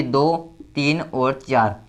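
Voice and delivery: slow counting. Shown as a demonstration. Teacher counting valences on board.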